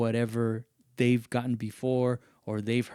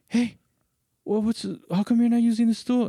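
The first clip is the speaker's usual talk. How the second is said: raised, higher voice calling from a little way off; elevated voice; 11 semitones higher, 4 dB louder than usual. Retold or imitated. Coworker calls over from a distance, raised voice. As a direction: call it over some distance without yelling. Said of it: "the voice is a little bit elevated".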